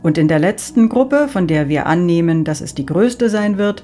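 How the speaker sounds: Saint Peter announcing. Acted. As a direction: direct the souls like a gatekeeper.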